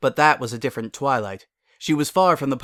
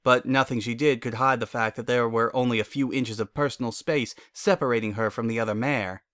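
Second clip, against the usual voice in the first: usual speaking voice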